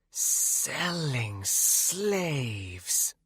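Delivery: very slow, eerie drawn-out wraith; creepy wraith voice. Acted. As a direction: drag it out menacingly. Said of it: "just as creepily".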